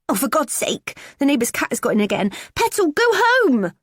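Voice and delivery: high, loud, exasperated call; shooing the cat. Acted. Daughter breaking off to shout at the neighbour's cat. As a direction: yell at a cat to get out.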